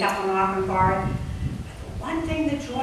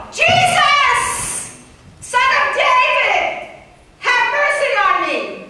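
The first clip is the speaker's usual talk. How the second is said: much higher, louder, slow desperate scream; screaming plea; 7 dB louder, slower than usual. Acted. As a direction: scream it even harder than the crowd. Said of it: "He screamed all the more".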